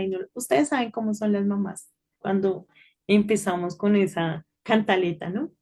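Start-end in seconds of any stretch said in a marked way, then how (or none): none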